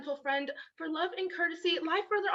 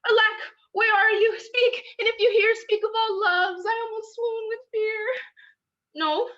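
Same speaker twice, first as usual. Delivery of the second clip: frantic calling; 4 semitones higher, 9 dB louder than usual. Acted. Hermia searching for vanished Lysander.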